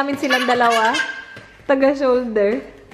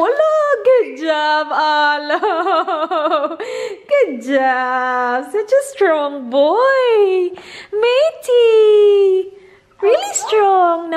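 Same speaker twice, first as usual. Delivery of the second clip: cooing praise; 5 semitones higher, much slower than usual. Real, not acted. Mother praising baby for rolling over. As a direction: gush over his big achievement.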